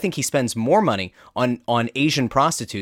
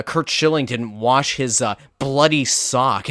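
normal talking voice

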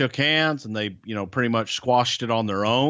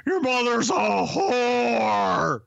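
very high, drawn-out hammed-up holler; Terry Funk; 11 semitones higher, much slower than usual. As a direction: stretch the insult out in a high put-on voice. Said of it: "what would Terry Funk have said".

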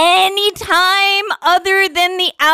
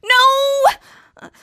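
panicked no; 7 semitones higher than usual. Acted. Panicked plea.

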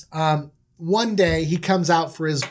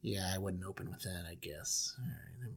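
very low, soft, reluctant aside; Jacob; 12 semitones lower, 21 dB quieter than usual. Acted. Dad doubts the nap opening, low and muted. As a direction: concede it quietly, far down in pitch. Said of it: "Jacob's like".

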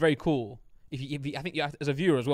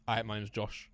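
lightly voiced impression, low and soft; Josh; 4 semitones lower, 7 dB quieter than usual. Shown as a demonstration. Player imitating a friend on request, light low impression. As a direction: introduce yourself in a slightly lowered, subdued put-on voice. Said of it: "do a Josh impression".

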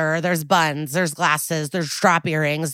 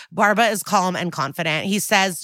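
ordinary delivery

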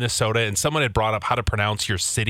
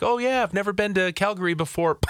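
higher-pitched casual self-quote; breezy, higher retelling of own remark; quoting himself casually; 7 semitones higher than usual. Retold or imitated. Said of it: "I would be like".

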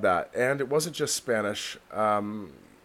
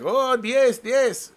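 driver's voice; 11 semitones higher, 5 dB louder, much slower than usual. Retold or imitated. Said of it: "And he's like".

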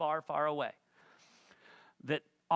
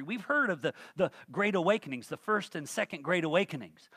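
ordinary talk

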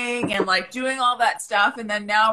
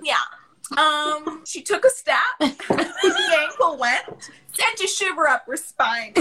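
put-on higher, bubbly valley girl voice; 5 semitones higher than usual. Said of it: "in a Valley Girl voice".

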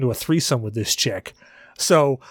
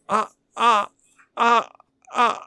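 stammering uh; 8 semitones higher than usual. Retold or imitated. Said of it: "And I'm like".

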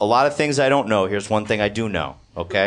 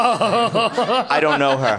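slow, high, drawn-out diva dismissal; 7 semitones higher, much slower than usual. Retold or imitated. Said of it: "quoting [name] meme".

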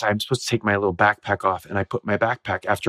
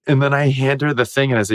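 usual speaking voice

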